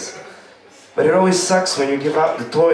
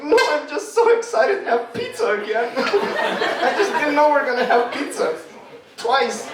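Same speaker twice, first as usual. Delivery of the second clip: much higher, giggling fake cheer; laughing through fake excitement; 13 semitones higher than usual. [name] hiding his burning mouth, high and giggling. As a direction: cover the pain with forced giggly joy. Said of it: "I'm like".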